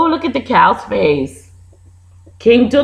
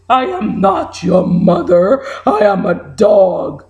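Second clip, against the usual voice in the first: a hint of a deep dog; lightly voiced dog saying no; lower dog voice; 6 semitones lower than usual. Dog answers the bird.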